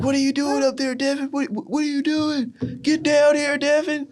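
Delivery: very high-pitched mock calling; incredulous calling up. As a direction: imitate teammates calling up in disbelief. Team calling up to the balcony.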